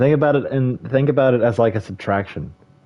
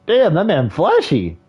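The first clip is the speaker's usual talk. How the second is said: impressed exclamation; 8 semitones higher, 4 dB louder than usual. Real, not acted. Impressed reaction.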